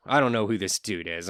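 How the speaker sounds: normal talking voice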